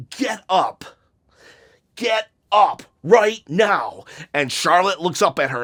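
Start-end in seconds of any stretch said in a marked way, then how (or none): 0.1-4.0 a slow, louder order to get up, as Becky; stern command. Retold or imitated. Becky standing over Charlotte, slow stern louder command. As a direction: bark it down at her, slowly. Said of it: "and she says".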